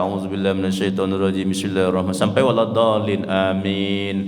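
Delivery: low chanted recitation of Al-Fatihah; reciting Al-Fatihah. Acted. Companion reciting Al-Fatihah over the sick child, low chant. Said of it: "bacalah Al-Fatihah".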